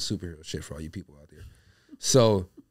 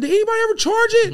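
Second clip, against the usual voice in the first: much higher, louder squawk of a question; high mock shout; 7 dB louder than usual. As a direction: yelp at nobody in particular. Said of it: "I said".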